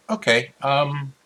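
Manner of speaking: slow, slightly high reluctant handover; Yozi conceding. Acted. Yozi hands the dagger back to Fifi, slow and reluctant. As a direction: drag it out, giving in. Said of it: "Yozi would be like".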